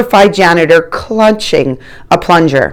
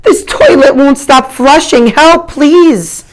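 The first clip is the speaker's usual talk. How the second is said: high, louder frantic pleading; a trapped man's high, loud frantic plea; panicked plea; 9 semitones higher, 3 dB louder, slower than usual. A narrator's reading. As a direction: beg for help over rushing water. Trapped man pleading from bathroom.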